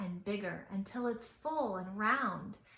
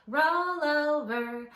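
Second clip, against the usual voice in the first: slow, louder roll over call; bear's command; 4 semitones higher, 5 dB louder, slower than usual. A narrator's reading. Brown bear telling the others to roll over, slow and loud. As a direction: draw out each roll over like a grumpy bear. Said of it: "the brown bear said".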